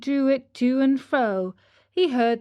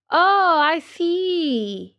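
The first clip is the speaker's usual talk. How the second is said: put-on amused old carpenter; 7 semitones higher, 4 dB louder, much slower than usual. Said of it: "laughing and scratching his wig".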